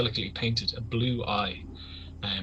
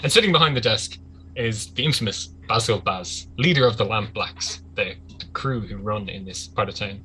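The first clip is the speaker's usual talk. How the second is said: normal talking voice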